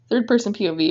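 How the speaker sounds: plain reading voice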